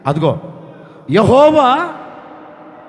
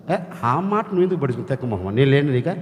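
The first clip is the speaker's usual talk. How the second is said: lower, softer, tender scolding; gentle as a man; 7 semitones lower, 5 dB quieter, faster than usual. Acted. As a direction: comfort her like a close friend. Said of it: "ఒక మనిషి ఎలా మాట్లాడుతున్నాడు". (దేవుడు రాహేలును మనిషిలా మృదువుగా ఓదార్చడం)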